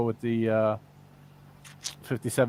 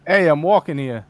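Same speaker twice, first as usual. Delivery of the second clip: a bit louder New York accent, stress on "walking"; 3 semitones higher, 6 dB louder than usual. Shown as a demonstration. Guest doing the New York line the viewer asked for, a bit louder. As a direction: bark it like a New York pedestrian. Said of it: "Can you please say".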